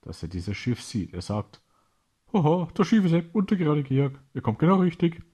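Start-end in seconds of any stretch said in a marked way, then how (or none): 2.3-5.2 higher, louder triumphant laughing cheer; exultant villain cackling; gleeful laugh. A narrator's reading. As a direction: crow with delight at the arriving ship. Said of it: "Der Cousin lacht und freut sich".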